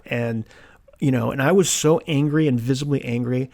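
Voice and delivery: ordinary talk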